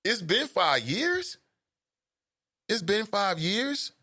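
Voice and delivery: slow, high incredulous question; can't believe five years went by; drawn-out high astonished echo; disbelief. Retold or imitated. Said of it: "The whole family is like".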